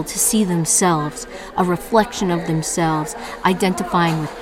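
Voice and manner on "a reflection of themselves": ordinary delivery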